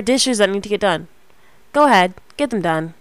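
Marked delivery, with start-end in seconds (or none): none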